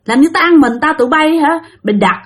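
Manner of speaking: high-pitched indignant scolding; drunken cursing. Acted. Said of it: "ông Sáu Xị này mới chửi".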